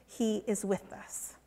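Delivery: quietly, with conviction; hushed. Acted. Preacher closing the refrain for listeners, hushed. Third repetition, emphasis.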